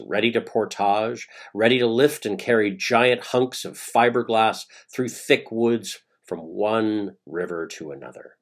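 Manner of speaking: usual speaking voice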